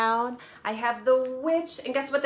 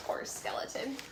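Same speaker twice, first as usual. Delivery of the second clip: whispered pity; 12 dB quieter than usual. Acted. Sympathy for skeleton.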